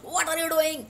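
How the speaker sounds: high, level pitch to show anger; irritated demand, pitched high throughout; annoyed high pitch. Shown as a demonstration. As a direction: hold the question up high on one level. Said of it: "अगर मेरा same high pitch में".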